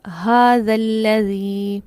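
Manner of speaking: हाज़ल्लज़ी said slowly, doubled lam; slow demo reading. Shown as a demonstration. Teacher introduces the next example word, slow. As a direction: read हाज़ल्लज़ी slowly and clearly. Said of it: "next मिसाल देखिए".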